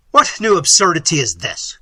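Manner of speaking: loud, scornful outburst; exasperated cry. A narrator's reading. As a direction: cry out at their nonsense. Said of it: "cried Manfred".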